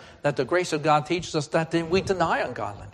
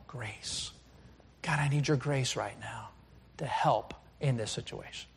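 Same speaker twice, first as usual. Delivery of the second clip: very soft plea to God; quiet, subdued petition; hushed prayer; 2 semitones lower, 11 dB quieter than usual. Acted. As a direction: murmur it as a private prayer. Acted prayer on the bus.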